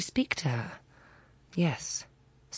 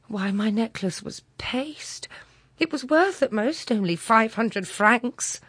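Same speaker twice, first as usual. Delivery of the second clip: deeply moved; 6 semitones higher, 4 dB louder than usual. Acted. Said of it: "deeply moved".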